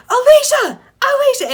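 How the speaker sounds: high, loud re-enacted yell of a name; urgent high-pitched holler, acted out; calling out. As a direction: shout after a friend to stop. Shouting to stop a frantic friend.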